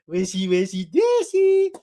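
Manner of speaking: put-on high, swooping goo-goo cooing at a toddler; hammed-up baby talk with squealed peaks. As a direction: coo mockingly as if to a tiny girl. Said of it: "said, Goo goo gaga, ooh, little girl".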